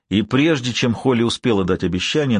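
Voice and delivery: ordinary delivery, nothing marked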